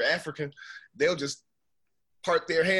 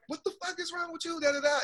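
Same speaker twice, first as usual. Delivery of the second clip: high-pitched imitated outrage; indignant retort. Friend confronting the salon owner, high-pitched indignant outburst. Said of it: "my homegirl gets in her face and was like".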